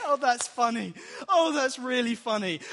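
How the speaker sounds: loud, high mock amusement; hammed-up hilarity. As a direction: crow it like the joke just landed. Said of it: "everyone starts laughing".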